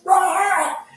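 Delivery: high, sudden scream as the body bursts; a short cry of pain cut off; dying scream. Acted. Dwarf split apart.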